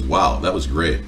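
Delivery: slow, low imitation of a flat "Wow, that was great"; husband imitating his wife's half-hearted praise, slow and low; mock unimpressed. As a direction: mimic a wife's deadpan praise. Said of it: "she just goes".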